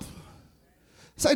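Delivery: ordinary delivery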